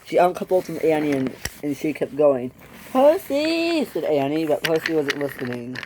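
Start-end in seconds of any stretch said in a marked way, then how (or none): 2.9-3.8 high, drawn-out call. A narrator's reading. Annie calls to Percy, who isn't listening, calling out.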